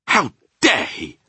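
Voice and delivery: high, loud, slow outrage; indignant cry. Acted. Sophie outraged at the man-eating giant, indignant cry. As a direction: cry out, weighting each word. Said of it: "Sophie cried".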